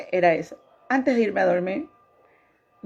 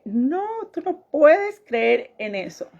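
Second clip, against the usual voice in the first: higher, slow earnest insistence, mimicking the believer; imitating her coworker; 7 semitones higher than usual. Coworker urging the crying pregnant woman, imitated in higher, slow pleading tones. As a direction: firmly tell her not to believe it, in a raised voice. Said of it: "ella me dice".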